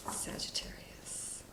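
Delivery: a slow breathy whisper of the sign; whispered name. Real, not acted. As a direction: whisper "Sagittarius" slowly, no voice. Drawing a card, whispering sign name.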